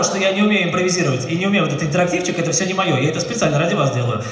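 normal talking voice

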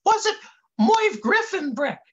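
very high, eager sidekick voice; Dummerton. A narrator's reading. Interrupting Brick with a guess, high eager voice. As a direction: butt in with a high goofy voice. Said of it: "said Dummerton".

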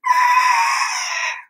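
very high pretend trumpet call; elephant trumpet. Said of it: "I can turn into an elephant".

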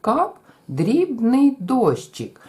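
Кап, кап slow, дрібний дощик slow; Кап, кап, дрібний дощик, six even syllables; counted syllables. Shown as a demonstration. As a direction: count it out beat by beat. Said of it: "Порахуємо склади".

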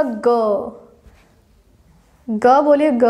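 ग held out with the vocal cords buzzing; voiced ग. Shown as a demonstration. Teacher has students feel throat vibration, voiced ग. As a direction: sound a long, voiced ग. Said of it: "स्वर तंत्र में कंपन हो रहा है".

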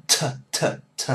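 whispered aspirated "T, t"; breathy puff on each "t"; "T, t, t" as unvoiced bursts; puffed t sound. Shown as a demonstration. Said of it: "Nhớ đó".

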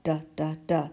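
short repeated duh sounds; letter sound d. Shown as a demonstration. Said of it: "then you make the digging dog's tongue go up".

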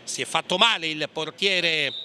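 ordinary talk, nothing marked

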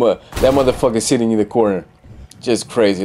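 usual speaking voice

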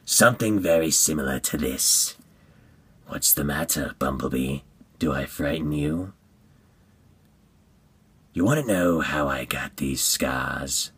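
low, soft, menacing clown villain; hushed, sinister madman; Joker. Acted. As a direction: taunt quietly in a low, creepy villain voice. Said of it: "if I could retool my Joker voice".

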